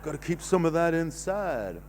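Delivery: usual speaking voice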